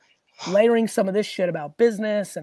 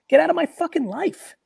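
dismissive brush-off; 8 semitones higher, 4 dB louder than usual. Retold or imitated. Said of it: "Reply with ease".

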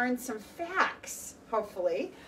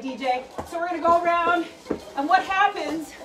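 normal talking voice

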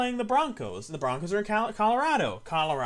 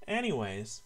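quiet reset; 8 semitones lower, 7 dB quieter than usual. Real, not acted. Sudden calm after yelling.

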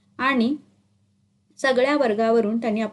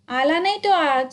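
chorus shout; 5 semitones higher than usual. A narrator's reading. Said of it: "एक सुरात एकदम ओरडली".

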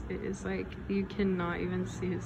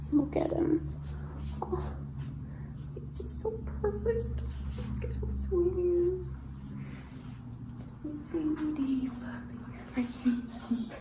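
much higher gushing pet voice; 8 semitones higher than usual. Real, not acted. Owner cooing about her perfect puppy, high baby talk. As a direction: melt over a cute puppy.

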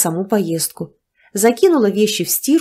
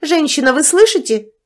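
higher, louder, calling her out sharply; impatient call; 8 semitones higher, 4 dB louder than usual. A narrator's reading. Neighbour repeating her demand to the woman, sharper. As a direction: raise it, she isn't listening.

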